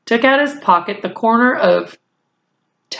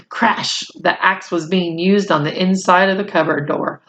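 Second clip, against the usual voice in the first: plain reading voice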